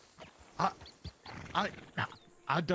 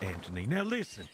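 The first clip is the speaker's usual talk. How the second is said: usual speaking voice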